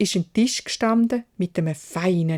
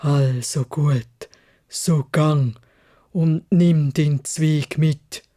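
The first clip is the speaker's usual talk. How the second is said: tiefes, langsames Krächzen des Biests; krächzend; 3 semitones lower, 3 dB louder, slower than usual. Acted. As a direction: lass das Ungeheuer gnädig, aber schwer krächzen. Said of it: "krächzt". (Biest stellt krächzend seine Bedingung)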